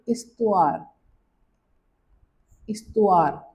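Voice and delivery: इस्तुआर with silent h; French histoire. Shown as a demonstration. Teacher models इस्तुआर, dropping the h. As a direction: say इस्तुआर, no h sound. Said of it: "इसको बोलोगे".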